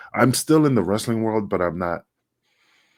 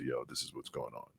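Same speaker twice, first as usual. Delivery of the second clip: very soft, low murmur; hushed confiding; 7 semitones lower, 20 dB quieter than usual. Acted. Confiding in a friend in the hotel room, hushed. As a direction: drop right down as if behind a closed door. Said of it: "close the door in the hotel room and say".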